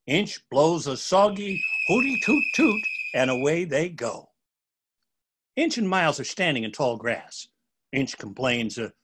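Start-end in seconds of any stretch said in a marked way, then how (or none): none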